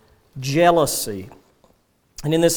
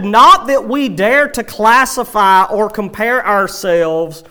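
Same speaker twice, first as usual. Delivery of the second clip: louder and higher, driving preacher's read; fervent preaching; 4 semitones higher, 4 dB louder than usual. Acted. Preacher reading Paul against false teachers, fervent. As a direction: proclaim it like a warning from the pulpit.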